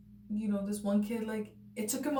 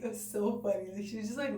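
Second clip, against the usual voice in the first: normal talking voice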